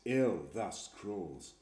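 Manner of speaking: ordinary delivery, nothing marked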